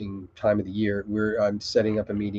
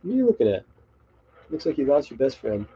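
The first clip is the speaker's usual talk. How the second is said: pet talk; 3 semitones higher than usual. Real, not acted. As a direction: tease the cat gently. Talking to the cat.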